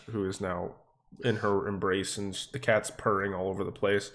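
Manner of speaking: ordinary talk, nothing marked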